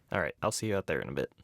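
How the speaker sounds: ordinary delivery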